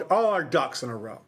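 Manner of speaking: ordinary talk, nothing marked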